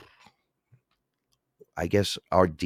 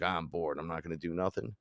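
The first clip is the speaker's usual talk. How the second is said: much softer, mock bored mumble; 2 semitones lower, 8 dB quieter than usual. Imagining a lazy version of himself, mock bored. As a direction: play a lazy, bored slacker. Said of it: "be like".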